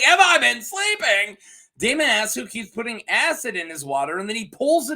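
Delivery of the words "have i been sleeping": high, loud incredulous echo; hammed-up outraged repeat; mock indignant question. Host as Daemon answering Simon, mock indignant.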